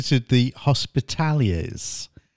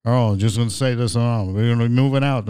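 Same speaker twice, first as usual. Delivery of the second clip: lower, [name]-like cartoon voice; Johnny Bravo; 4 semitones lower than usual. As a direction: lightly do an [name]-style cartoon character. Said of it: "he talks like [name]".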